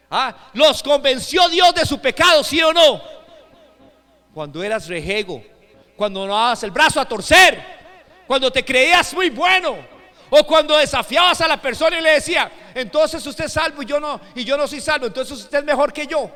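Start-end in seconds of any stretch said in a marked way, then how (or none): 0.1-3.0 loud, high-pitched pulpit shout; full-force preaching. Real, not acted. Preacher telling the congregation the Spirit convicts of sin, shouted preaching. 6.0-16.3 loud, raised pulpit voice; forceful preaching. Real, not acted. Preacher reminding listeners of their old pride, forceful preaching.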